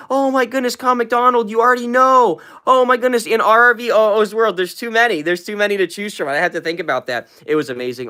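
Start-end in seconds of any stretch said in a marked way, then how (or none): 0.1-6.3 high, loud gushing shout-out; delighted livestream hype; excited shout-out. Real, not acted. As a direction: gush over the viewer's name, thrilled. Thrilled shout-out to a commenter.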